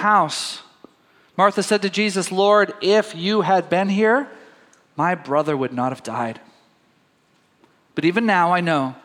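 reads the passage aloud, lifting for the quotes; scripture reading. Acted. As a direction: read it like a Sunday lesson.

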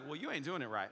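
low, soft coworker's remark; understated, dropped-down deep voice. Retold or imitated. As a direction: imitate an unruffled deep-voiced man. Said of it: "He's got this deep voice".

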